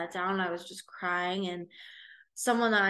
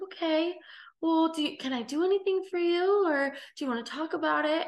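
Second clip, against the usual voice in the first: much higher, eager and fussing voice; worried stranger girl; 9 semitones higher, 4 dB louder than usual. Retold or imitated. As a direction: imitate the girl offering help, pitched up high. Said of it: "And she was like".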